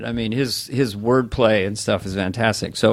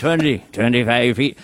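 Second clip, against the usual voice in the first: Robert Shaw impression. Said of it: "Robert Shaw in Jaws".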